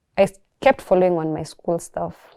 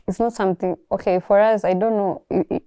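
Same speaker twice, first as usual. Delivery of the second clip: normal talking voice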